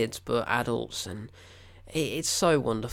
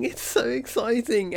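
high and slow, exciting stretched; real giddy excitement; squealing excitement; 9 semitones higher, 4 dB louder, slower than usual. Real, not acted. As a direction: gush it out high.

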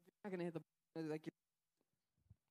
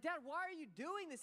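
higher, louder, pleading cry; frightened son; 8 semitones higher, 4 dB louder than usual. Retold or imitated. Son crying in the flames, begging his dad, frightened. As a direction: plead with your father. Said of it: "he's like".

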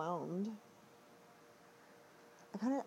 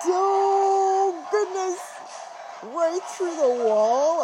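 shocked outburst, much louder; startled loud exclamation; 9 semitones higher, 14 dB louder, much slower than usual. Real, not acted. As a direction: blurt it out, jolted by a scare. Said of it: "I gotta tone this down. It's a little loud".

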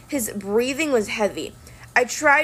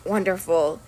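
muttered; 3 semitones lower, slower than usual. A narrator's reading. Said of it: "Talia muttered".

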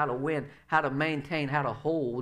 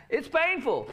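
laughing; 8 semitones higher than usual. Shown as a demonstration. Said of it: "Say that with me".

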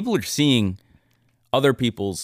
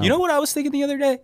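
excited; much faster than usual. Real, not acted. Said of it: "sudden excited new topic".

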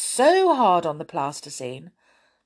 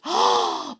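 sharp gasp. Acted. Amelia sees the beetles.

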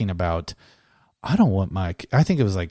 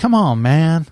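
a higher, louder hint of Biden's catchphrase; Biden's come on man; 8 semitones higher, 9 dB louder, slower than usual. Shown as a demonstration. Podcaster showing his Biden try, a light, higher, louder impression. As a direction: toss it off like a folksy old politician. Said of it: "Like that".

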